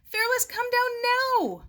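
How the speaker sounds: very high, loud command; firm urgent call. Acted. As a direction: order him down the cliff. Calling dog down cliff.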